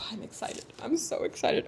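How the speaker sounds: hushed, airy anticipation after a sigh; very soft, unvoiced-sounding thrill; excited whisper. Real, not acted. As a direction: whisper your excitement to yourself. Building excitement before opening box.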